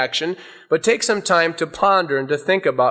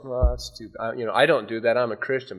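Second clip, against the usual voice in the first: indifferent shrug; 5 semitones lower, 5 dB quieter than usual. Retold or imitated. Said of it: "do you just go".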